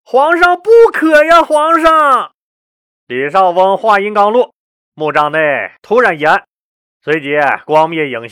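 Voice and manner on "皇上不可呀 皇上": very high, loud, alarmed plea; panicked conjurer crying out; high-voiced shout. A narrator's reading. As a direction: yell to stop the emperor, pitched way up. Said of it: "高声叫了一嗓子".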